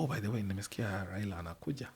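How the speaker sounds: hushed, quick confidential aside; retold whisper. Tipping off one of the protocol people, in a hushed undertone. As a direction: slip it in very softly and fast. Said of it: "whisper to one of the people".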